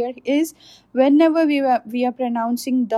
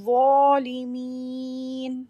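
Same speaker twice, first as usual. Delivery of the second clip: Quran recitation. Shown as a demonstration. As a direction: recite it with tajweed.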